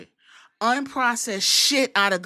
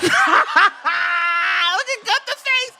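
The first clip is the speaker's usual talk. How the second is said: slow, loud shout broken by laughter and crying; cry-laughing yell; 6 semitones higher, 8 dB louder, much slower than usual. Real, not acted. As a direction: howl it through tears of laughter. Laughing at wrestler's reaction.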